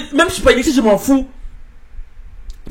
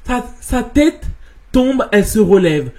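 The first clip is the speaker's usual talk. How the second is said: usual speaking voice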